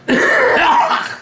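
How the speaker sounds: single sharp cough; harsh cough. Real, not acted. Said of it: "You mind not coughing over the pie?".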